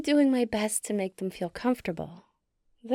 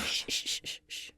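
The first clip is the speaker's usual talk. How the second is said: soft urgent shushes; fading hush; shushing; 9 dB quieter than usual. Acted. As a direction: hush them so the baby sleeps. Human has fallen asleep.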